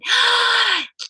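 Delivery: big high-pitched indrawn gasp; re-enacted gasp. Daughter suddenly seeing her Father, astonished gasp. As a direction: gasp in sudden joyful recognition. Retelling joyful sight of the Father.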